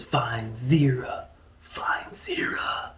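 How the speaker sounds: slow breathless whisper, imitating the actor; dramatic whisper. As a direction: hush it out slowly, mocking the movie line. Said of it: "That's exactly how that rolls out".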